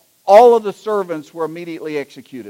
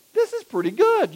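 high-pitched dawning delight; acted-out impressed intern; pleasantly surprised; 12 semitones higher than usual. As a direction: lift it high, as a happy discovery.

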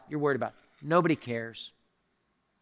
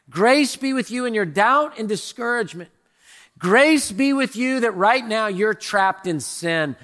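louder, higher, building preacher cry; preaching at full force; 9 semitones higher, 7 dB louder than usual. Acted. Pastor blessing the congregation in a rising, loud crescendo. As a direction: lift the room with each repeated blessing.

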